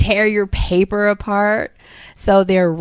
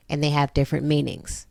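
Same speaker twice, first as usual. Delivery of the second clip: usual speaking voice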